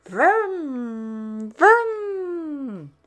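high, drawn-out vroom; car engine noise. Acted. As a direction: rev like a little toy car. Imitating a toy racing car.

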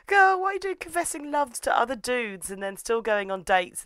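high-pitched exasperated telling-off; mock scolding. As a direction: tell her off in hammed-up disbelief, voice high.

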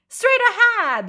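high, loud call; excited cry. A narrator's reading. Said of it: "the frogs cried out excitedly".

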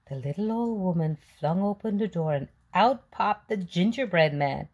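ordinary delivery, nothing marked

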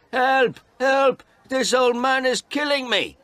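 very high, loud panicked cries; screaming for help. A narrator's reading. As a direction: shriek like he's being beaten. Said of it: "He shouted".

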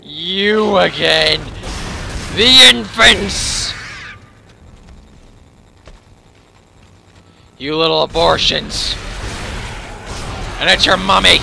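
loud mocking yell at monsters; taunting shout. Real, not acted. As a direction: bellow insults at them. Yelling at enemies in game.

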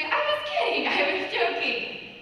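squeaky high, then a slow dragged joking; mock panicked take-back. Bride at the altar trying to take it back, mock panic. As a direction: pretend to laugh it off in a rush.

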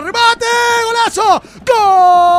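long roaring goal call; goal shout. Real, not acted. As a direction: scream the goal with everything. Goal call in live commentary.

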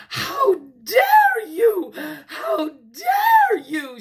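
high, loud, outraged shriek; indignant, drawn-out yelling; angry shout. A narrator's reading. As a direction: stretch it out in shrill outrage. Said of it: "she shouted. Miss Elma was angry".

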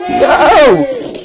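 high cry breaking into laughter; laugh-yell at the failure; laughing shout. Real, not acted. Jump fails.

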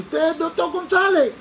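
put-on high, booming drunken accost; imitated shouting. Drunk stranger accosting the secret visitor, imitated shouting. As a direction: bellow the question, stretching the last word. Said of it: "gritaba, digo".

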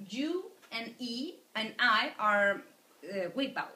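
usual speaking voice

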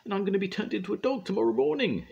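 high, fast, panicked gasp. A narrator's reading. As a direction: rush it out in alarm. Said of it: "she gasped".

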